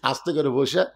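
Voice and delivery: usual speaking voice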